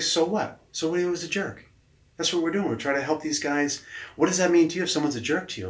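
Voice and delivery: lightly voiced boss challenging him. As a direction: press him with a blunt question.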